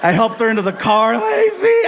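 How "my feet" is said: high-pitched mock wail; melodramatic wailing mother. Mother being helped into the car, high hammed-up wail. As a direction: moan like a telenovela mom in agony. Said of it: "this living novela".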